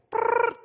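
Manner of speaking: short, high "brrr" trill; rolled trill noise. Pretend. Preacher mimes searching for financial blessing scriptures, high trilled whirr. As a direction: make a quick buzzing whirr.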